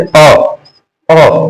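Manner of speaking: अ followed by a throaty h; mouth then throat. Shown as a demonstration. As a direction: open अ in the mouth, finish it from the throat. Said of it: "फिर कंठ बज रहा है".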